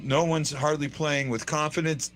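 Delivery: ordinary delivery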